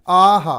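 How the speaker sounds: hammed-up teacher's yell of pain; loud cry of pain. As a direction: cry out as if a bee stung your forehead. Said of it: "जोर से चिल्लाता है".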